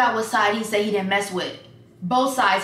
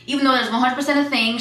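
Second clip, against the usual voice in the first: ordinary delivery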